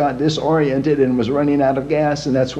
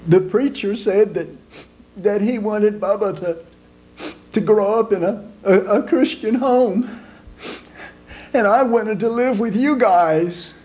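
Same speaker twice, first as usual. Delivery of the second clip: put-on high, halting child's voice; much higher small boy explaining to his father; little boy voice; 7 semitones higher than usual. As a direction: stammer it out like a tearful little kid.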